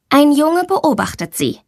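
ordinary delivery

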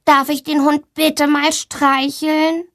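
put-on slow, high child's voice; pupil asking permission. A narrator's reading. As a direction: draw the request out like a polite schoolboy. Pupil Max asks the teacher permission.

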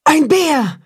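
wolf mother panicking; frightened cry. Acted. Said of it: "ruft die Wolfsmutter erschrocken".

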